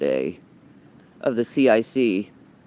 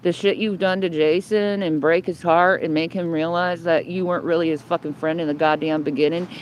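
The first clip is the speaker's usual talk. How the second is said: ordinary talk, nothing marked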